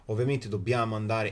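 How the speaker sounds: ordinary talk, nothing marked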